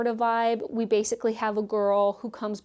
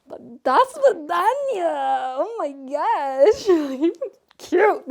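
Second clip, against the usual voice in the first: slow, loud, higher fangirl swoon; swooning delight; 5 semitones higher, 6 dB louder, much slower than usual. Real, not acted. Reviewer swooning to viewers over the Russian character, slow and giddy. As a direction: melt over the name, drawn out.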